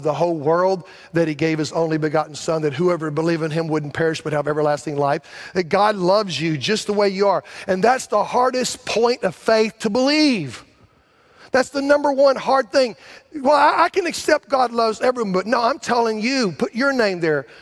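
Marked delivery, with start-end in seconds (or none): 5.5-17.5 preaching at full force. Acted. Pastor urging the congregation to believe God loves them, in full preaching force. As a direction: drive it home like a revival preacher.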